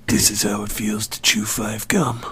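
hushed ad voice; ad narrator whisper. Shown as a demonstration. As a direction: whisper it like a gum ad. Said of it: "Hold on, let me see".

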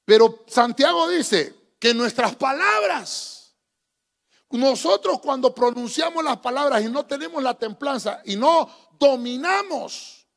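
slow, loud sermon delivery; fiery preacher, slow and strong; emphatic preaching. Real, not acted. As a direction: stretch each word for weight.

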